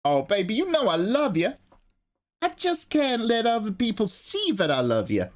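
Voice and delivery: high, hammed-up sweetheart voice; Nathan. Nathan secretly professes love to baby, high hammed-up impression. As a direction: croon it as a high-pitched mock lover. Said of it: "that's what Nathan sounds like".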